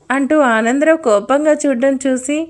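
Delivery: usual speaking voice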